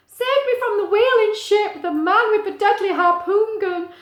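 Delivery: high, loud, wailing whale voice; whale song. A narrator's reading. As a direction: wail it like a lost whale mother. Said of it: "That was supposed to be a whale song".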